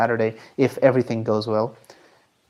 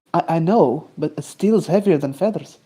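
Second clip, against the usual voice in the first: insistent mock Scottish accent; stammer, then insist in a Scottish accent; imitated stubborn Scot; Scottish meme accent; 3 semitones higher than usual. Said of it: "I just do the meme very well".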